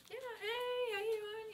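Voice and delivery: breezy, easy hello to familiar faces; cheery greeting. Retold or imitated. Greeting people at the clinic, high cheery hello.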